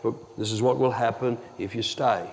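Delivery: hushed, low-key private warning; talking really quietly. Retold or imitated. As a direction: drop your voice for a quiet word. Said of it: "I was talking really quietly".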